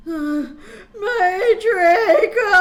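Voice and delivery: very slow loud crying wail, high; hammed-up wail of grief; moaned. Vampire wailing over lost Draco, slow loud cry. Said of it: "He moaned".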